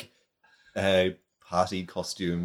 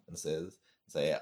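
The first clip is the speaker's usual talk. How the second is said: ordinary talk, nothing marked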